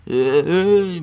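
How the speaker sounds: lightly imitated zombie sound effect; zombie-like groan. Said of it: "なんかゾンビみたいなね".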